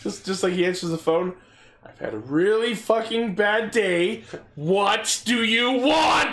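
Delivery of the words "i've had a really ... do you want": slow grumpy answer. Retold or imitated. Imagined angry phone answer.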